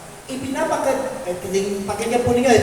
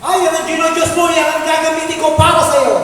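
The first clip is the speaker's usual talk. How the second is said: mock God scolding Pharaoh; 4 semitones higher, 6 dB louder than usual. God voiced threatening Pharaoh.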